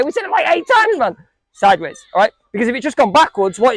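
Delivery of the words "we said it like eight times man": high-pitched exasperated reminder; mock exasperation. Real, not acted. Coach reminds Jay of the repeated answer, exasperated. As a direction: chide a player who keeps forgetting, pitch jumping up.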